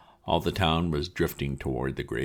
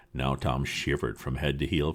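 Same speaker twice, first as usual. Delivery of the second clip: plain reading voice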